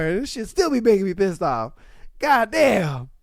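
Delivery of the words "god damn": usual speaking voice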